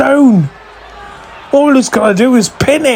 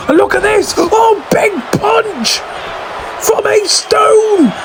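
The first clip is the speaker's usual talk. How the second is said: screaming; 9 semitones higher, slower than usual. Real, not acted. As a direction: yell it from the top of your lungs. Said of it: "Screaming at the top of my lungs".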